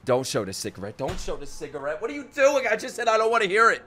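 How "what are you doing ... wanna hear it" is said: ordinary delivery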